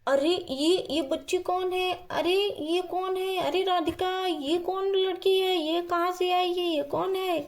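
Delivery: mild mock outcry of surprise; startled husband. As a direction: play a man lightly alarmed by a stranger. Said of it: "इतने ज़ोर ज़ोर से क्यों चीख रहे हैं". (चौंके हुए आदमी की हल्की चीख-पुकार)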